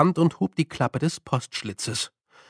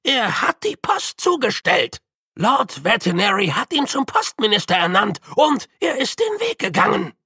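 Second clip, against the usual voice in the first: put-on old man arguing; 6 semitones higher than usual. A narrator's reading. Grütze argues.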